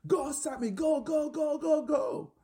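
very high-pitched cheer at ordinary volume; retold excited shout. Bobsledder urging his driver Sammy down the track, re-enacted shout. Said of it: "shouting at the top of my lungs".

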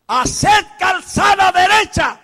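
slow, loud proclamation; prophetic cry. Acted. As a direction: call out like a prophet.

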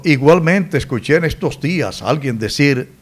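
ordinary talk, nothing marked

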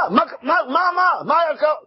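exasperated shout. Acted. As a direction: burst out yelling in disbelief. Homeowner erupts. (פרץ צעקה גבוהה ומגמגמת)

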